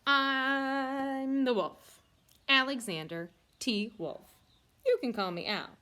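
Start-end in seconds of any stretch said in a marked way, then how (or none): 0.1-4.3 drawn-out menacing wolf intro; slow dramatic reveal. Acted. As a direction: stretch it out like a big reveal.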